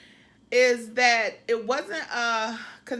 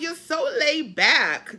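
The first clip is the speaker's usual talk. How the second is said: slow, louder New England drawl; New England accent; 3 semitones higher, 7 dB louder, slower than usual. Retold or imitated. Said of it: "do my New England accent".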